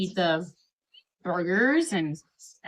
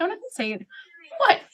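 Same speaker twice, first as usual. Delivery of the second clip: mock innocent; 10 semitones higher than usual. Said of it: "And I'm like".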